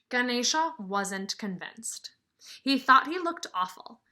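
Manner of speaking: lively storybook reading; reading to children. Acted. Teacher reading a picture book to her class, lively storybook voice. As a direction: read it out to the kids with some sparkle.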